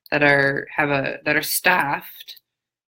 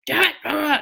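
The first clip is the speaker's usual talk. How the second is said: mock yelling owner; 11 semitones higher than usual. Imitating an owner yelling at her dog at the park, high mock shout. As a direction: shout after a dog that won't listen. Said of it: "yelling at the dog and yelling at the dog".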